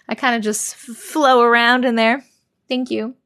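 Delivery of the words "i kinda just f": normal talking voice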